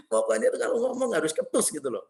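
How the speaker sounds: ordinary talk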